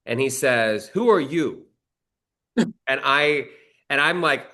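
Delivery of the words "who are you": higher, sharp mock tough-guy challenge; imitating the mob guy. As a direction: play the suspicious boss sizing him up. Mob guy questions narrator at dinner.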